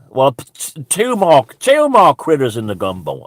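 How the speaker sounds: ordinary delivery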